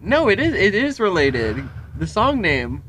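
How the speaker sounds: high-pitched burst of realization; sudden realization. Real, not acted. Interrupting own story on finding the link, suddenly realizing. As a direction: blurt it out as it dawns on you.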